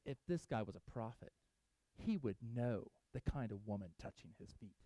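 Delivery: low, soft scornful aside; muttered inner thought. Retold or imitated. Pharisee scoffing inwardly at the weeping woman, low and soft. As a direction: mutter it under your breath in scorn.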